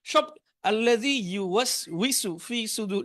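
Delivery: Arabic pronunciation of আল্লাযী ইউওয়াসউইসু, slow and careful; Arabic recitation. Shown as a demonstration. Teacher showing Arabic recitation to students. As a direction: recite সুদুর with true Arabic sounds. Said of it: "এটা কিভাবে আপনি বাংলায় লিখবেন".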